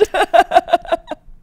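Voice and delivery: a loud, high pained laugh. Real, not acted. Laughing off a rejected show, loud and high. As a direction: laugh off a sore point.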